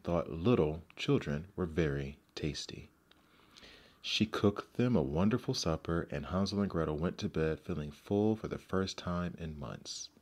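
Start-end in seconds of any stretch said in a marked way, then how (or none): none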